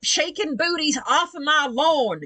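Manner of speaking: high, slow Southern holler; Southern accent. Pretend. Southern mum clearing her lawn, Southern accent. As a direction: drawl it out in mock Southern outrage. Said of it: "I became Southern just then".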